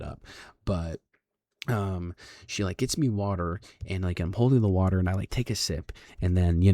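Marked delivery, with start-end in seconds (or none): none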